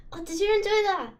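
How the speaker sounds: usual speaking voice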